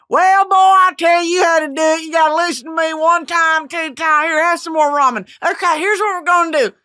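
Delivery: hammed-up Southern old-timer giving advice; Arkansas accent. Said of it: "from Arkansas".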